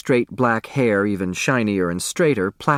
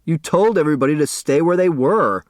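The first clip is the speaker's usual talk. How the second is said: son answering back; 6 semitones higher, 4 dB louder than usual. Acted. Son answering back his father.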